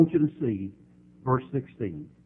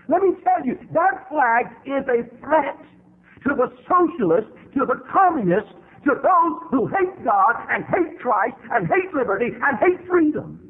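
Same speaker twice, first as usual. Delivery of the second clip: rising higher and louder, fervent sermon climax; preaching at full force; 11 semitones higher, 4 dB louder than usual. Acted. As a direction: build and build until the voice cracks.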